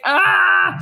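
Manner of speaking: loud, high wordless cry; mock yell. Neighbour losing it over plants, mock yell. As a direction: let out an exasperated yell. Imitates her own outburst.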